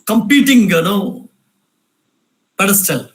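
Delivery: ordinary talk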